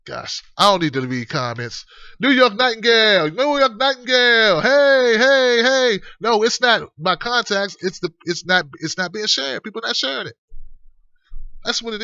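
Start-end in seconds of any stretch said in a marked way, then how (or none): 2.2-6.0 high, stretched-out sing-out; calling out. Real, not acted. Host hailing a viewer in the chat, calling out. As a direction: holler the name across to get their attention.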